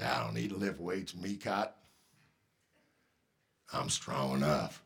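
deep growl of a lazy strongman; Big Fred's deep growl. Retold or imitated. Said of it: "deep, growly voice like this".

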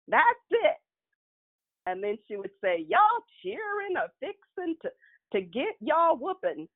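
high, fed-up Mississippi grandmother; Mississippi dialect. Retold or imitated. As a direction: Mississippi grandma losing patience. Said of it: "her Mississippi dialect".

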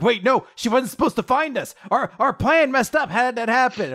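high, panicked whine; frantic high-pitched protest; terrified criminals. Acted. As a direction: blurt it out in mock terror. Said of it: "criminals start getting really terrified".

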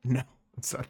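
teasing whisper. Real, not acted. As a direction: whisper it playfully. Teasing denial of a guess.

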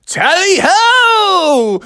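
high, loud, drawn-out cry; tally-ho shout. Acted. Horse setting off with a long, high, loud call. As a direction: call the charge.

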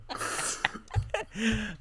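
soft trailing laugh; breathy laugh. Real, not acted. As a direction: laugh it off quietly. Laugh after outburst.

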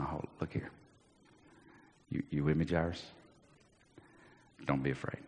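very soft, low and tender; steady the frightened father; hushed comfort. Acted. Jesus calms Jairus.

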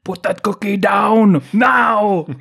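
high, slow mock bellow in Austrian accent; hammed-up shouted order, Austrian accent; Austrian action-hero impression. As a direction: bellow it slowly like an Austrian muscleman. Quoting Arnie's film line in impression.

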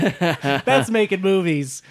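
loud, high, drawn-out delight; exuberant film-fan cheer. Real, not acted. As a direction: proclaim it slowly with triumphant relish. Cheering a great film scene.

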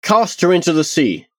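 high-pitched, mildly angry command; restrained, high, angry insistence; snapped order. Acted. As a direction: press the order without shouting. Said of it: "Tarsia snapped".

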